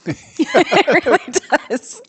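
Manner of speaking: high, loud, slow laugh-talk; words squeezed out through laughter. Real, not acted. Laughing at her own reaction.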